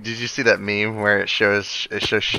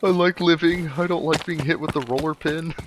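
high mock kid voice; 8 semitones higher than usual. Mocking a scared kid voice.

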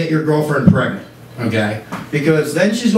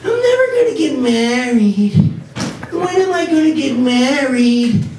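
much higher, whiny lovelorn woman voice; mock the heroine's wedding angst; Sex and the City heroine; 9 semitones higher than usual. Comic mocking the show's heroine, whiny high voice. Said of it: "kinda goes like this".

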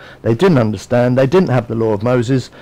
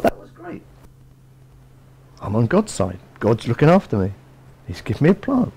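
Jonah pleased with himself; 5 dB quieter than usual. Acted. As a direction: savour it like a smug prophet. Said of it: "and he thought".

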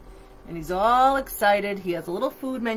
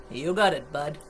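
hot dog vendor; 6 semitones lower than usual. A narrator's reading. Vendor answers the monster.

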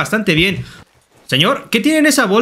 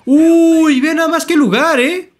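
amazed; 8 semitones higher, 3 dB louder, slower than usual. Real, not acted. Admiring the landscape.

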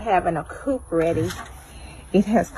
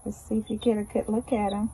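normal talking voice

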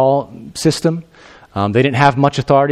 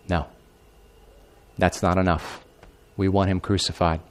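much lower, much softer cold demand; menacing crowd; 7 semitones lower, 9 dB quieter than usual. Acted. The crowd answering Pilate, demanding crucifixion in a low hush. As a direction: let the refusal land cold and low.

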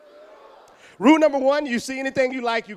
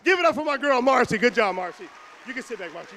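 ordinary talk

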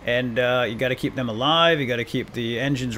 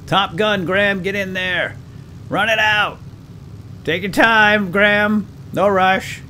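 high loud urging, half joking; hammed-up commander; playful shouted orders; 8 semitones higher, 5 dB louder, slower than usual. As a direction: yell at Graham to run, then mock patience. Hurrying crew member to the gun.

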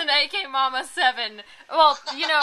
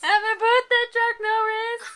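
cheerful; 7 semitones higher, 3 dB louder than usual. Real, not acted. Birthday wishes to Chuck Norris.

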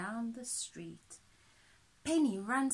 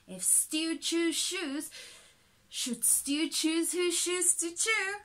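crisp, louder, careful twister recital; tongue twisters; 4 semitones higher, 5 dB louder than usual. A narrator's reading. Reciting tongue twisters to help Penny, crisp and louder. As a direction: rattle off each twister clearly. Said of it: "serious tongue twisters".

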